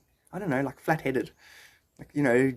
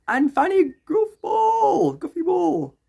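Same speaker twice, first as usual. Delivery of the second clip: high, slow and wailing; mock a crybaby reading the insult; wailing cartoon whine; whiny mock voice; 4 dB louder, much slower than usual.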